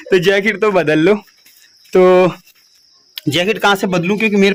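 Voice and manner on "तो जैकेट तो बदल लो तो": laughing while reading out a comment; amused laughing. Real, not acted. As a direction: let chuckles break up the words. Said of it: "मुझे खुद ही हंसी आ रही". (हँसते हुए)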